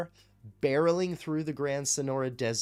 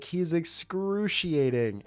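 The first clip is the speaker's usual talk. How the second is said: put-on drawn-out, higher-pitched foreign-accented guy; Adnan; 5 semitones higher, slower than usual. Streamer quoting fans of his character, drawn-out impression. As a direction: impersonate a reality-show guy, stretching the words. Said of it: "I'm just doing an impression of that guy".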